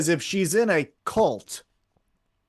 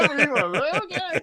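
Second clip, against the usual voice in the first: mock panic; 11 semitones higher, faster than usual. Co-host mimicking his panic at categorizing emails, squealed. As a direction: squeal it like a scared guy caught out. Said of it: "You got real scared".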